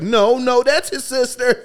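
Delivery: high, loud insistent wife; wife correcting him. Retold or imitated. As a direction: correct him sharply, slowed down.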